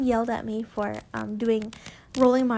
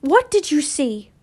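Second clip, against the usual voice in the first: urgent demand; 3 semitones higher, 7 dB louder than usual. Acted. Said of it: "turned quickly".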